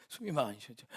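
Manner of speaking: laughing through the words; laughing at his own breathlessness. Real, not acted. Pastor laughing about not breathing when members are absent. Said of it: "숨도 잘 안 쉬어지고".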